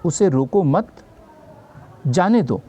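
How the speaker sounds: उसे रोको मत as one phrase, long break, जाने दो; pause after मत. Shown as a demonstration. Teacher repeats the example for students, pausing after मत. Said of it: "विराम चिन्ह कहीं और लगा देता हूँ".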